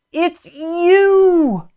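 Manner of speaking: high, long-held cry of recognition. Retold or imitated. Preacher retelling seeing the Lord appear, crying out in recognition. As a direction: cry it out high, holding the last word. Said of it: "and I cried out".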